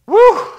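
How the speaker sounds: loud high whoop of relief. Real, not acted. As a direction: let out a big relieved whoop. Exhales after a hard moment.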